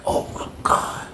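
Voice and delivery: breathless shocked whisper; voiceless stunned whisper; whispered oh my God. Retold or imitated. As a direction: hush it like you're scared to be heard. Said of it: "I'm like".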